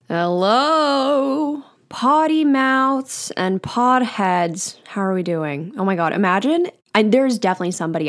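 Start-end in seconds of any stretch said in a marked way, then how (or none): 0.1-4.8 higher, very slow crooner greeting. Pretend. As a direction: croon the hello like an old-time lounge singer. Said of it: "I call it my [name] voice".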